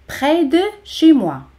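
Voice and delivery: "près ... de ... chez moi", carefully spaced; word by word. Shown as a demonstration. Separated model, then joined up.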